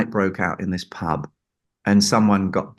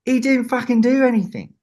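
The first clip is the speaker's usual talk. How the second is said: scornful John; 14 semitones higher than usual. Retold or imitated. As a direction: scoff at the absent engineer, pitched up. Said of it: "And he goes".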